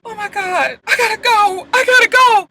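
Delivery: high-pitched, rising retold panic; panicked. Telling him she has to go, panicked. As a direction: act out frantic urge to escape. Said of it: "the tears started to come".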